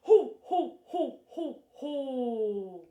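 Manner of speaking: deliberate, punched "ho ho" laugh; loud staged laugh, last one drawn out; low belly laugh. Shown as a demonstration. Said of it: "nice and low".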